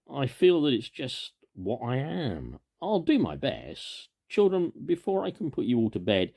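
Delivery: lightly voiced girl taking charge of the children; motherly Wendy. A narrator's reading. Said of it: "said Wendy".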